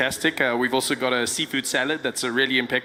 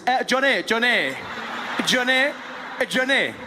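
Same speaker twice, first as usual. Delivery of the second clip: calling the waiter; 10 semitones higher than usual. Acted. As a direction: summon the waiter with rising high calls. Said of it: "Watch this".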